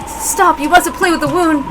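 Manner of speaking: high urgent protest; alarmed plea. Acted. Doctor begs Kanon to stop touching the wound, alarmed.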